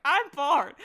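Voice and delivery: put-on high, loud childish whine of boredom; a bored child complaining in the back seat; whiny bored kid. Kid on long car drive, whining loud and high.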